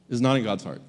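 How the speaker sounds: ordinary talk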